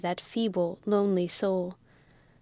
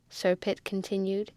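ordinary delivery

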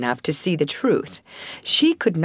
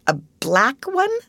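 higher, puzzled echo; bewildered; 7 semitones higher than usual. A narrator's reading. Amelia voiced.

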